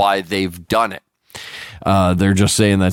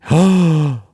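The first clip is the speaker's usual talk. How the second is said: exaggerated scandalized gasp; mock gasp; 6 semitones higher than usual. Reacting to a same-sex kiss, mock-shocked gasp. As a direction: gasp in fake horror.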